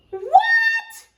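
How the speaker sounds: shrieked high, startled granny; high astonished granny cry; cried. Acted. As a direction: squeal it like a shocked old grandma. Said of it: "cried Granny".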